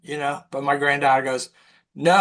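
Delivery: normal talking voice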